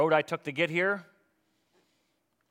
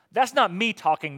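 usual speaking voice

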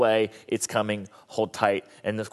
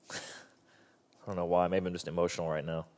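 softer, quietly emotional; choked up; 6 semitones lower, 10 dB quieter, faster than usual. Real, not acted. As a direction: hold back real tears. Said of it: "I choke up with this verse".

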